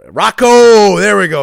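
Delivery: loud, high burst of recall; big relieved yell; triumphant shout. Real, not acted. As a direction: shout the name as it suddenly comes back. Triumphantly recalls the coach's name.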